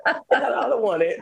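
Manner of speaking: high laugh rolling into the refusal. Real, not acted. Woman laughing off God's gift. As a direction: laugh it off, still giggling.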